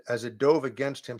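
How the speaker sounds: plain reading voice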